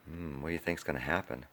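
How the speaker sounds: soft, fast teasing question; hushed aside. Real, not acted. Coach asking the kids what happens next, hushed. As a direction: lean in and whisper the question. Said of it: "aside to kids".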